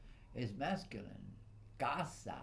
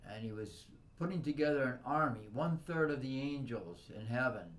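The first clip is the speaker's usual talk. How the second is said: louder, raised preaching indignation; angry rant; 4 semitones higher, 7 dB louder than usual. Real, not acted. Condemning the devil who betrayed God, loud and angry. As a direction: denounce the traitor with fire.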